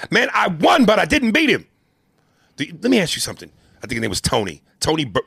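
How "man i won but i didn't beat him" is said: loud high Apollo voice, cocky. Retold or imitated. Boxer Apollo bragging to his trainer, loud and high. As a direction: boast it big and high.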